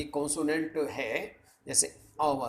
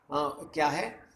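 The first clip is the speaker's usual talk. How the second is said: usual speaking voice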